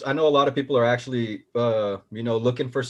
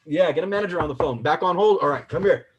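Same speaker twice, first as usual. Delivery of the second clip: higher, brighter mock phone voice; imitating phone call; 5 semitones higher than usual. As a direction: act out the call. Mimics phone call, rising pitch.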